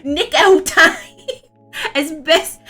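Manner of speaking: put-on high, loud, giggly baby-talk voice; cutesy uwu voice pushed up high between laughs. Streamer demonstrating her uwu voice for chat, high and giggly. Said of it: "with an uwu voice".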